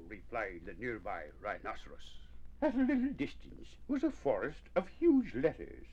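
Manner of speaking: plain reading voice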